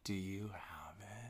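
hushed whisper so the caller can't hear. Real, not acted. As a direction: whisper it under your breath. Whispering during phone call.

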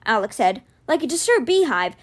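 plain reading voice

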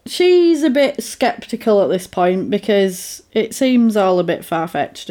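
ordinary talk, nothing marked

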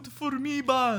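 high mock dad cheer; hammed-up high-pitched dad voice; TikTok dad impression. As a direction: copy a baseball dad hollering praise. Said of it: "What accent did it just do?".

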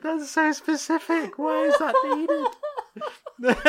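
squeaky high laughing words; talking through giggles. Real, not acted. Cracking up at the article.

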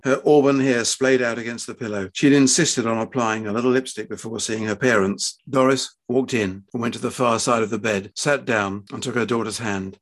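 plain reading voice

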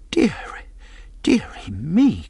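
high, drawn-out woman's lament; distressed cry. A narrator's reading. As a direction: wail it lightly as a dismayed mother. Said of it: "cried my mother".

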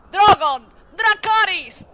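loud, high dragon command; shouted command. Acted.

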